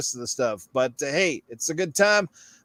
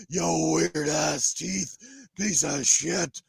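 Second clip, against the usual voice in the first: slow, gritty mock Batman growl; slow hammed-up caped crusader threat; much slower than usual. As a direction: growl it like the Dark Knight. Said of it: "the Dark Knight is here".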